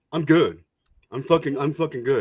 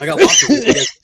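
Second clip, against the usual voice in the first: much higher and louder, half laughing; mock defensive squeak; 12 semitones higher, 7 dB louder than usual. Shown as a demonstration. Answering a teasing friend in a mock high squeak. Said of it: "teased about having friends".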